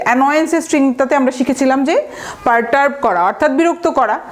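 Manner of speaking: ordinary talk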